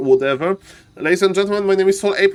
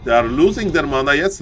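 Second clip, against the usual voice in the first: ordinary talk, nothing marked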